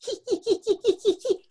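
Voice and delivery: high mischievous giggle; a cheeky little giggle. A narrator's reading. Ronald giggles at the prank.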